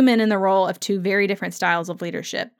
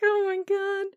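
much higher, breathless gush; excited squeal; 11 semitones higher, 4 dB quieter than usual. Real, not acted. Host reacting to Jamie and Claire's scene, squealing with delight. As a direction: swoon over the moment.

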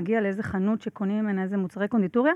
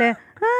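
imitated mixer sound; 3 semitones higher, 5 dB louder than usual. Engineer retelling the chef's cue to stop the mixer, imitated noise. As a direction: mimic the mixer changing its sound. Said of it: "כאילו, עם קולות".

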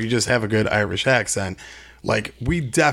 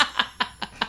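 high, soft trailing laughter; real laugh; 10 semitones higher, 9 dB quieter than usual. Real, not acted. Laughing after the fart joke, soft high laughter. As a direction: laugh it out softly, high in pitch.

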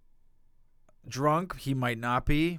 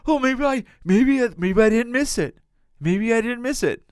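high, loud mock wishful thinking; exaggerated hopeful self-imitation, pitched up; 9 semitones higher, 7 dB louder than usual. Man mimicking himself hoping he had not missed it, mock hopeful. Retelling own wishful thought.

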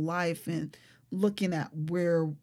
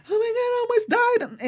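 much higher, frantic mock scream; 4 dB louder than usual. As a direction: squeal it like you just escaped death. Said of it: "screaming in the car, frantic".

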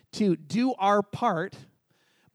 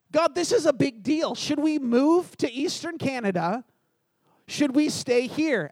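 higher, louder, exasperated plea to God; frustrated plea; 5 semitones higher, 3 dB louder than usual. Retold or imitated. Pastor retells begging God for an answer, frustrated. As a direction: push it like you've run out of patience. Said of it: "I'm getting kinda frustrated".

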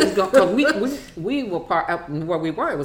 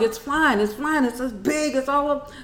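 high, breathless-sounding urgent rush of pleas; frantic child begging mom to flee; imitating panicked kid; 9 semitones higher than usual. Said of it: "I heard them scream".

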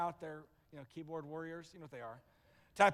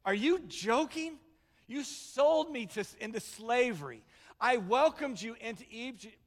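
acted hurt disbelief, pitch raised; wounded and offended; 3 semitones higher than usual. Joseph to the brothers who sold him, wounded and indignant. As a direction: protest as the betrayed benefactor, building in force. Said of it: "He was so wounded and offended".

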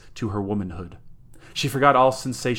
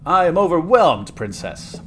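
loud, high mock-awe dropping away; overplayed sardonic gallantry; 7 semitones higher, 7 dB louder than usual. A narrator's reading. Villain bowing to his accomplice, sardonically. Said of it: "murmured Constantius sardonically".

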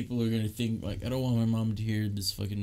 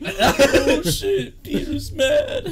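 high, loud shout through laughter; squealed, drawn-out joke reaction; laughing mock alarm; 11 dB louder, much slower than usual. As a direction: crack up while yelling in pretend shock. Joking reaction to imitated angry outburst.

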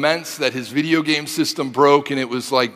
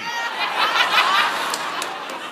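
breathy laugh. Real, not acted. Audience-facing laugh.

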